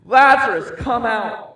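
loud, high raging command; furious, booming call; angry shout. Acted. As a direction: shout it with full force, as if to wake the dead. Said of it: "then he shouted, angry Jesus shouted".

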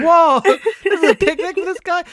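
a hammed-up whoa of disbelief; mock amazement. Mocking a rich man's picnic, mock amazed. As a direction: gape at the lavish picnic, half laughing. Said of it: "I'm like".

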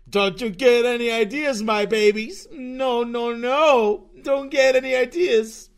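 high, loud sing-song scolding voice, as if to babies; mock cooing scold. To his viewers he calls babies, a loud mock cooing scold. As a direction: wag a finger at the viewers like toddlers in a shrill high put-on voice.

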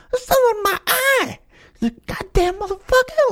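high, strained mock freak-out; hammed-up high-pitched cursing fit; pissed-off outburst. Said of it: "he gets so pissed off".

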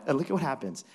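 usual speaking voice

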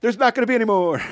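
high, loud, playful; playful mock band statement; mock declaration. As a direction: announce it with fake finality.

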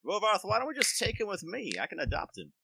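high put-on accent as Sven; odd accent. Sven offers to adopt the baby dragon, in a high odd accent. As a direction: suddenly slip into a strange accent. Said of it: "Don't know why I went with that accent".

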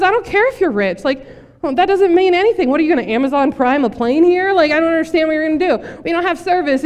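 high-pitched exasperated rant; freaking out. Acted. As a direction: play the panicked castaway arguing back. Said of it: "one guy is freaking out".

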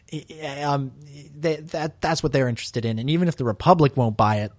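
ordinary talk, nothing marked

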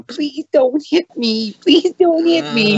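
very high, drawn-out pleading whine; squeaky mock-pleading falsetto; mocking fairy voice. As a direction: plead like a tiny helpless fairy, mockingly slow. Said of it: "flying around with little wings on going".

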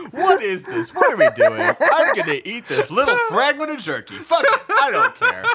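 high laughing squeal; giddy laughing. Real, not acted. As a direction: laugh through the words, high-pitched. Giddy laughing high-pitched outburst.

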